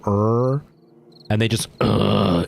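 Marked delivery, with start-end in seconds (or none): 0.0-0.6 North American-style "arr" growl; mock dog growl. Shown as a demonstration. Streamer mimicking how North Americans do dogs, mock growl. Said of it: "the States and Canada, they go". 1.8-2.4 throaty mock growl. Shown as a demonstration. Streamer showing viewers the throat technique, mock growl. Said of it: "in their throat to do the".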